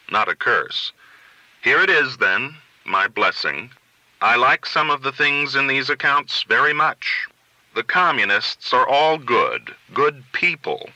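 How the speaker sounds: plain reading voice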